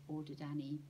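plain reading voice

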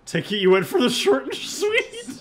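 high, loud put-on voice; hammed-up high ribbing; teasing character voice. Voices character teasing a quick return.

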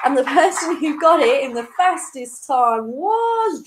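ordinary delivery

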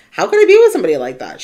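loud, fast incredulous question; disbelieving outburst; indignant. Retold or imitated. Indignant quoted inner voice.

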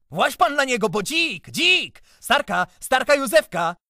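very high, loud, frantic yelling; crazed shouting. A narrator's reading. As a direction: scream the taunts like a madman. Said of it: "popędził w las jak oszalały".

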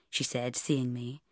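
ordinary delivery

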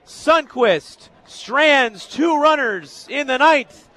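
loud, high play-by-play voice; elated sportscaster closing out the game; triumphant final-out call. Real, not acted. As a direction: announce the win with full excitement. Calls the final out live.

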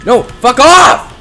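slow, loud, high yell; angry shout. Real, not acted. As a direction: snap it out in fright. Yelling at an animatronic.

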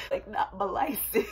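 high, whimpering plea that dissolves into laughter; laughing fake despair over the license; mock whine, laughing. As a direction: play up the horror of losing the license while cracking up. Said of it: "I was just like".

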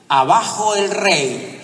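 slow, loud rebel shout from the crowd; defiant cry of a revolutionary; shouted from the back. Retold or imitated. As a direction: yell it up from the back of the hall. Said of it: "se oyó una voz al fondo del auditorio".

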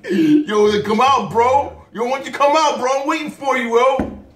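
high, loud mock challenger; voicing the kangaroo. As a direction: call someone out as a cocky kangaroo. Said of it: "That's what he said".

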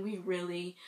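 ordinary talk, nothing marked

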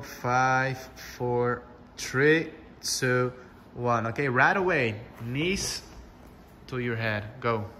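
ordinary talk

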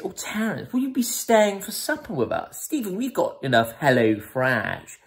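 high, put-on hostess voice; posh girlfriend. Girlfriend invites Terence to supper, high posh voice. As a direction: lilt it high and genteel.

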